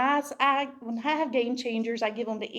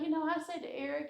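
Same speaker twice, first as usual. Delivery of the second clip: ordinary talk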